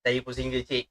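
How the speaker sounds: ordinary talk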